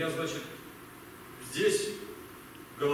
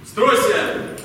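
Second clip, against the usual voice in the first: loud, raised drill order; commanding officer's shout; military command; 6 semitones higher, 4 dB louder than usual. Acted. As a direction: call the squad to fall in. Officer calls a command to recruits.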